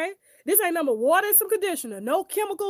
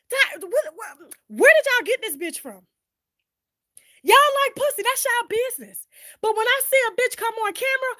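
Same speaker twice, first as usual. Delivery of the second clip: retold angry callout; 5 semitones higher than usual. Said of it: "I told, I was like".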